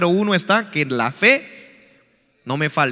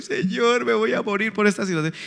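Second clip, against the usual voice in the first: softer whiny imitation of crying; mock tearful plea; 3 semitones higher, 4 dB quieter than usual. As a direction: pretend to whimper to God. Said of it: "llorando por su situación". (imitando un lloriqueo suave)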